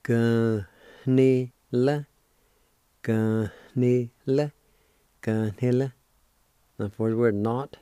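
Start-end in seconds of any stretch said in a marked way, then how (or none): 0.0-5.9 ganila drawn out slowly, stress on the ni; Cherokee ganila. Shown as a demonstration. Teacher repeating ganila slowly for students. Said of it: "it's pronounced like this".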